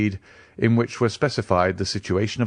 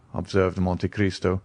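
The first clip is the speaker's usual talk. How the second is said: ordinary delivery, nothing marked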